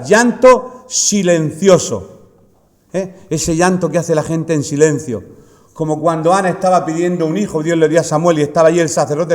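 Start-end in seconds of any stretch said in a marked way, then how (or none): none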